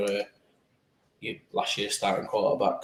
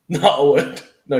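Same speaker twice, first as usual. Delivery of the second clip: laughing, louder; 4 semitones higher, 10 dB louder than usual. Pretend. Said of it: "Just joking with you".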